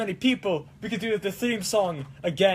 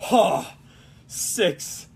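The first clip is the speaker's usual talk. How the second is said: ordinary talk, nothing marked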